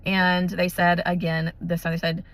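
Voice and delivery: normal talking voice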